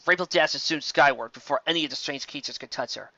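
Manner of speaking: usual speaking voice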